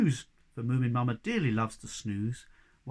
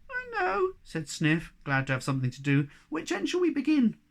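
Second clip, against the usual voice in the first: small eager Sniff voice; 12 semitones higher than usual. A narrator's reading. Little Sniff's voice.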